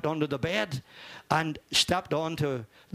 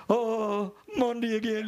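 drawn-out, mildly put-on moan; mock Monday groan; 4 semitones higher, slower than usual. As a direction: imitate workmates dreading Monday. Mimicking workmates dreading Monday morning.

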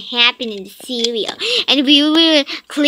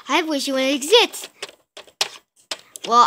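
normal talking voice

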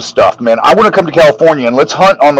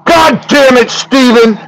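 high-pitched mock exasperation; exasperated outburst; 9 semitones higher than usual. Retelling friend's call about a missing order, mock exasperated. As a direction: imitate a friend cursing in frustration.

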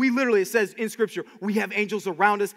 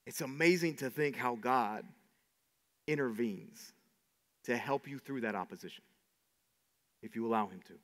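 very soft and low, with long pauses; hushed awe; 11 semitones lower, 13 dB quieter than usual. Real, not acted. Preacher reassuring the congregation that God intervenes, hushed awe. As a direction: let it sink to a quiet, low close.

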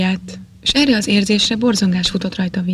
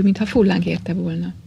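usual speaking voice

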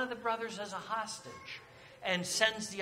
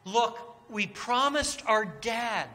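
louder, slower pleading from Judah; urgent plea; 4 semitones higher, 7 dB louder than usual. Acted. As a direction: make the brother's appeal land hard.

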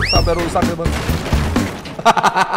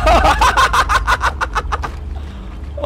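high breathless laugh. Real, not acted. Laughing at game chaos.

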